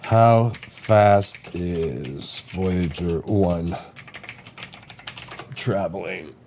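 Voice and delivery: slow, carefully spaced question to a voice assistant; slow voice query. Real, not acted. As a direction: spell each word out slowly for the machine. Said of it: "I could punch it up".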